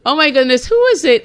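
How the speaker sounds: high, giggly, beaming; laughing. Real, not acted. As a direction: talk through laughter, way up high. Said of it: "you guys can hear my smile".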